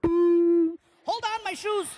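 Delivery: high, loud mock panic; urgent high-pitched yell; alarmed shout. Alarmed by the weight shown.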